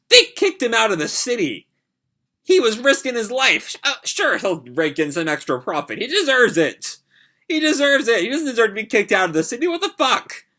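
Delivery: "He deserves it" pushed up hard in disbelief; outraged rant. Real, not acted. Reviewer fuming at the guild for exiling Scout, outraged.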